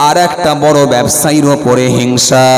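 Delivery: loud, full-force sermon voice; fiery preaching. Acted. Preacher warning the crowd about envy, full force. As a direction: thunder it at the congregation.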